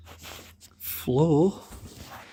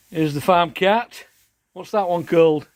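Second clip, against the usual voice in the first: usual speaking voice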